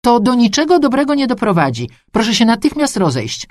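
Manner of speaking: high-pitched, urgent official warning; nervous, insistent command; agitated appeal. A narrator's reading. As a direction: urge the crowd to disperse, on edge. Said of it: "zdenerwowany mężczyzna w garniturze".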